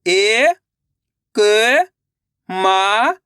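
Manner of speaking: slow, separate syllables, then the word drawn out; patient teacher dictating syllables; syllable by syllable reading. Shown as a demonstration. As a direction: spell each sound for beginners, then blend.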